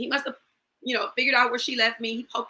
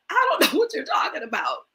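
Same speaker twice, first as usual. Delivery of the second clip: higher-pitched, imploring retold protest; 9 semitones higher, faster than usual. Wife to husband pinning her to the wall, protesting. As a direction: plead it at a raised pitch. Pleads while pinned by husband.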